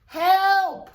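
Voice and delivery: high, loud cry of help. A narrator's reading. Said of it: "cried Toad".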